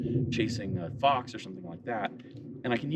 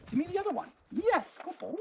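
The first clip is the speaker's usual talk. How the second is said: much higher sing-song praise; high dog praise voice. Real, not acted. Owner rewarding her dog, high sing-song praise. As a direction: gush over him in a high voice.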